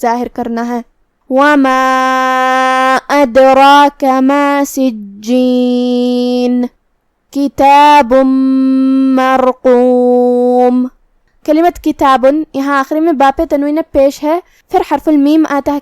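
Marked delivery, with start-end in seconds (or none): 1.3-10.9 drawn-out tajweed chant, clear nun at the stop; slow Quran recitation. Shown as a demonstration. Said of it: "اس پہ جزم سے رکیں گے".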